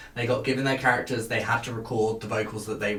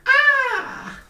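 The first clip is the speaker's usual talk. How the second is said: gremlin squeal; 6 dB louder than usual. Retold or imitated. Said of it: "she's constantly going".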